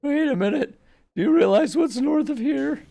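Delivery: put-on very high, loud panicky old man; panicked old guy voice. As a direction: yelp it like a frightened old hero. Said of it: "a panicked old guy voice".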